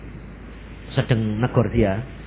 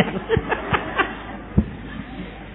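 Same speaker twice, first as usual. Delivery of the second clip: high, wheezy laugh at own joke; breathless giggle trailing on; 5 dB quieter than usual. Real, not acted. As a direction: laugh helplessly after the punchline. Laughs after his own joke.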